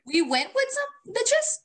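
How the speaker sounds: very high, slow disbelieving "we went with some bitches"; stunned friend questions the story; incredulous. Real, not acted. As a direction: sound truly shocked you forgot.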